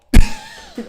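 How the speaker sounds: loud high shriek of a laugh; screaming laugh; burst of laughter. Real, not acted. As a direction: explode into laughter. Cracking up at own joke.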